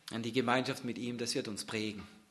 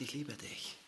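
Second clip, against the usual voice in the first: hushed tender voice; 9 dB quieter than usual. Acted. Jesus's words, hushed.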